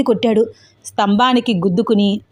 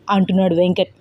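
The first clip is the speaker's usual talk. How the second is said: usual speaking voice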